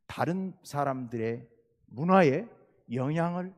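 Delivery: ordinary talk, nothing marked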